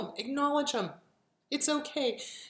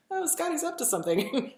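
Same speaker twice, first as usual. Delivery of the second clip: a light, higher mock dog voice; voicing the dog; 6 semitones higher than usual. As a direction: play the tattletale dog, lightly. Dog telling on Scotty.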